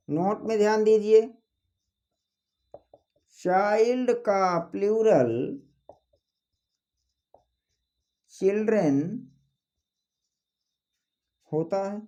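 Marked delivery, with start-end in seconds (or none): 3.4-12.0 drawn-out phrases with long pauses; slow dictation. Acted. Teacher giving students a note point, slow dictation. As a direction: dictate the note slowly, piece by piece.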